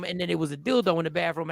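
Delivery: normal talking voice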